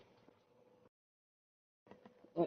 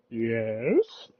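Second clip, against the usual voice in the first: imitating unsure. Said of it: "very unsure what that's coming from".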